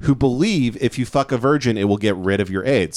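ordinary delivery

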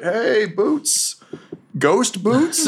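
high voices peeking in, questioning; tentative greeting. Acted. Greenbeard and Slim Jim greeting Boots, tentative. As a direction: call over high and uncertain, ending on a rise. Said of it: "They're like".

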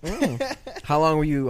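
normal talking voice

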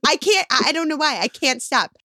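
a hint of a fond, fed-up spouse; exasperated husband. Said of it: "he looks at me like".

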